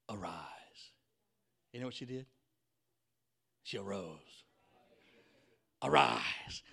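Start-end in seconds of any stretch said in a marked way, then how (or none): 0.1-0.9 low, soft whisper; hushed command. Acted. Jesus tenderly raises the girl. 5.8-6.6 loud, unvoiced burst of breath; forceful command. Repeats the command with more force.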